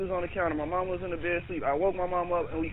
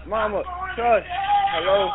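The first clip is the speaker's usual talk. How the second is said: calling out; 11 semitones higher, 5 dB louder than usual. Real, not acted. Caller hushing mother, calling out.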